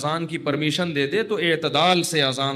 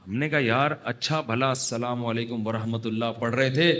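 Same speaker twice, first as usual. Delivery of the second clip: ordinary delivery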